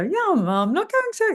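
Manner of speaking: absurdly high, stumbling demo. Shown as a demonstration. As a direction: try to say bad news way up high and fail. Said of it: "You can't pitch your voice and be honest".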